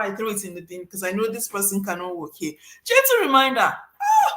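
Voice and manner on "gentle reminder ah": high, loud scoff at gentle reminder; mock outrage with a high cry; mocking exclamation. Scoffing at the phrase gentle reminder, loud high mock outrage.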